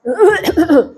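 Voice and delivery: old man's cough, imitated; short put-on throaty cough; acted cough. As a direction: mimic a sick man coughing. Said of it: "dia batuk".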